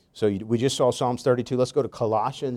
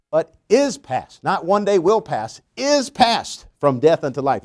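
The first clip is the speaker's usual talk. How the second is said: fervent scripture preaching; 3 semitones higher, 5 dB louder than usual. Acted. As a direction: proclaim it to the congregation with rising force. Preaching scripture at full force.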